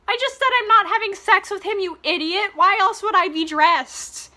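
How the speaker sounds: high, loud, hammed-up indignation; exasperated outburst. Reader voices Tessa arguing with jealous Hardin, exasperated mock yell. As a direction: act out yelling at an idiot, fed up.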